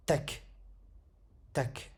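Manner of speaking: TAK cut short on the K; vowelless final letter. Shown as a demonstration. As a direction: stop dead on the K of TAK. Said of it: "On tombe sur la lettre comme ceci".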